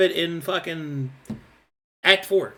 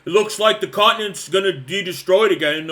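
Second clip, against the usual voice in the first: mocking bored player; 2 semitones higher, 4 dB louder than usual. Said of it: "everybody's like".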